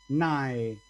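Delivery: "nae" run together as a single syllable; don't split "nae", glide through the vowels; "nae" as one diphthong syllable. Shown as a demonstration. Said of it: "no separo".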